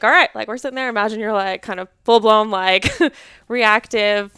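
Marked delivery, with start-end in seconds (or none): 0.0-0.3 brisk call. Retold or imitated. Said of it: "I'd be like".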